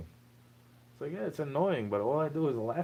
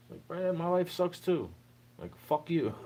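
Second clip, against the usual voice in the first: higher, heated snap back; angry outburst; 7 semitones higher than usual. Real, not acted. Ranting at someone who hates their life, angry retort. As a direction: throw it back at them, fed up.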